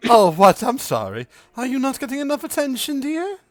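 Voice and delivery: high, fast mocking coo; catty mother. Mother needles Fia with a high, fast, mocking coo. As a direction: tease the jealous girl sweetly. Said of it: "Fia, and goes".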